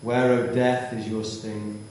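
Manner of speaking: ordinary talk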